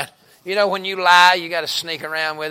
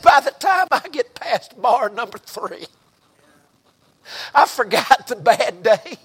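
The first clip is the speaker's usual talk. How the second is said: rising excitement; 8 semitones higher, 8 dB louder than usual. Retold or imitated. Woman's testimony building in excitement.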